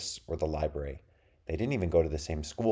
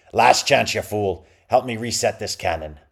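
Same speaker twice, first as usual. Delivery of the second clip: pirate captain voice; 4 semitones higher, 8 dB louder than usual. A narrator's reading. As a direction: snarl defiance at the rival. Bluebriar orders ship's boy.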